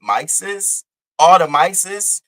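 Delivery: "mices" stretched out slowly, mocking the wrong plural. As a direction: sneer out "all the mices" like a quote of his mistake. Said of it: "you can't even say mice".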